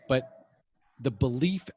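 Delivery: ordinary talk, nothing marked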